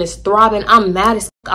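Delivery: ordinary delivery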